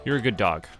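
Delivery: usual speaking voice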